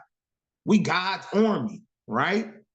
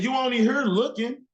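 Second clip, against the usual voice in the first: higher, sneering put-down; scoffing older brother; 8 semitones higher than usual. Retold or imitated. Older brother Eliab scolding David, higher and scoffing. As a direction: brush the kid off as a gawker. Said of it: "He said".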